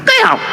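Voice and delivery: short, high comic squawk; mock death squawk. Acting out the moment of death with a comic squawk. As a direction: squeak out a cartoon death noise.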